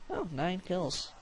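ordinary delivery

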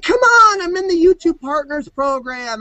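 high, whining, hammed-up begging; hammed-up high whine begging trolls for attention; mock whiny plea.

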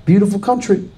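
mock-sweet border greeting; sweet Canadian welcome. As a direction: greet a visitor with cooing gentleness. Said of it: "they were just like".

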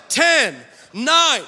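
loud called-out studio countdown. Retold or imitated. Studio crew counting down to air, loud countdown. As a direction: call each number out hard. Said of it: "they start counting down".

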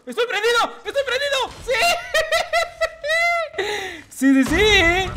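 very high, shrieking laugh-filled yells; laughing shout. Real, not acted. Gamer on a killstreak, laughing high shouts. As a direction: scream and laugh in triumph.

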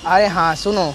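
ordinary talk, nothing marked

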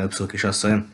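ordinary delivery

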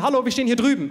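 loud, high call. Retold or imitated. Crowd calling out to Himmler where they stand, loud and high. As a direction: shout across to him.

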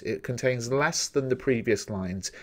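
ordinary talk

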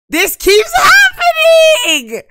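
slow, very high, loud laughing-crying shout; drawn-out shriek of frustration at the game; anguished wail. Real, not acted. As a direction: wail it out in mock despair.

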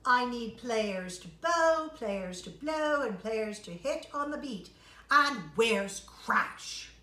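loud, impatient boss calling for players; grumpy conductor. Acted. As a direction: bark out orders like a fed-up conductor. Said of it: "he's kind of grumpy today".

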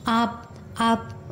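धीमी, अटकती, दबी आवाज़; डरी, सहमी लड़की. A narrator's reading. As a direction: speak very slowly and softly, faltering in fear. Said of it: "लड़की डर कर". (डर से हकलाती धीमी आवाज़)

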